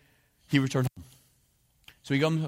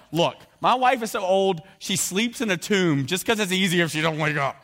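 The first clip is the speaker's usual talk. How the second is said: mock Zechariah joke; 5 semitones higher, 4 dB louder than usual. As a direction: ham up the insulting joke about his wife. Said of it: "He's going".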